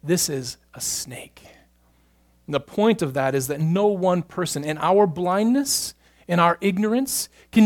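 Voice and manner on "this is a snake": whispered guess. Retold or imitated. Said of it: "and he says".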